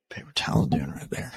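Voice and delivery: a low murmured aside to herself; whispered aside. Real, not acted. Host fiddling with the blinds, a low whispered aside. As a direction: mumble it under your breath.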